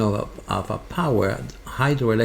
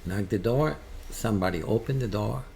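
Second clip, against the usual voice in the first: ordinary talk, nothing marked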